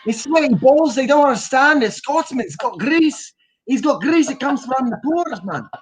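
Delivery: hammed-up Scottish groundskeeper complaining; aggrieved Scottish workman; Scottish accent. Shown as a demonstration.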